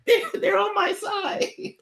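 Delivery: high panicked squeak; panicked inner voice. Retold or imitated. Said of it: "I'm like".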